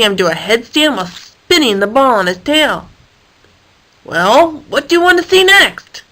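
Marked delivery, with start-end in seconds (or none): 4.0-6.0 high eager question; a little impatient, asking again. Acted. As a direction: ask again, keen and bright. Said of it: "David asked again".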